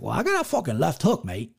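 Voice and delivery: badly faked English accent, slightly under the breath; mock English accent for an imitated rival; horrible English accent. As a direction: attempt a hammy English fighter's accent. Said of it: "That was a horrible English accent".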